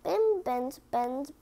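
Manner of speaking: ordinary delivery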